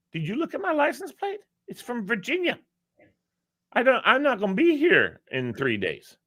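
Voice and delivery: high, exasperated pushback at the cop; pissy argument. Retold or imitated. Driver protesting to the lady cop, exasperated and high. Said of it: "I was pissy".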